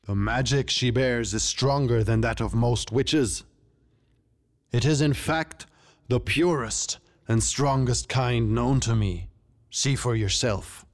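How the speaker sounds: stately, declaiming king; proud regal pronouncement; Tiger King. Acted. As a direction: declare it like a ruler at court.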